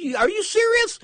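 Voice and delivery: way up high and loud, incredulous; shocked disbelief. Retold or imitated. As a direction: squeak out the question in total shock. Shocked at McDonald's coffee date.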